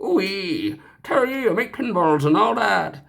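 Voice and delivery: put-on a clumsy over-the-top Canadian accent; bad Canadian accent Ben Heck. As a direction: ham up a Canadian accent badly. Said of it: "that was the worst Canadian accent I think ever".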